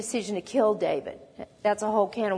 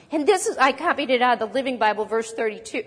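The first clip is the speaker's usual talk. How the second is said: normal talking voice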